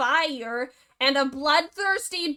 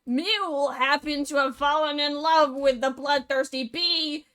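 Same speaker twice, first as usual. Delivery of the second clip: ordinary delivery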